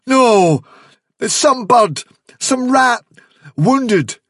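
loud, high, desperate denial; frightened outburst in short phrases; panicked cry. A narrator's reading. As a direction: cry out, grasping for another explanation. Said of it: "cried Dandelion".

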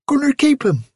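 put-on a girl insisting to her father; stubborn insisting. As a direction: dig in against dad.

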